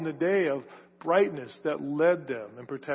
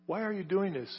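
softer, sorrowful plea; tender question; 2 semitones higher, 7 dB quieter than usual. Retold or imitated. Jesus asking Saul, soft and tender. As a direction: ask it gently, as a grieved friend. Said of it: "very affectionate".

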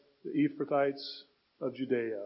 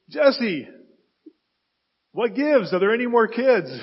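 raised, loud, exasperated questioning; exasperated demand; 8 semitones higher, 12 dB louder than usual. Acted. Prophet Samuel pressing Jesse about missing sons, exasperated demand. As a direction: demand an answer, loud and high.

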